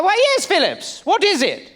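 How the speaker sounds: high, hammed-up British accent; old British gentleman. Colonist answering his friend Phillips, high mock British accent. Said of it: "He's like".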